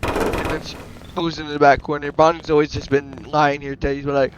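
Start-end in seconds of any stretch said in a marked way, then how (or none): none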